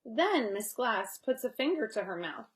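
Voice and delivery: usual speaking voice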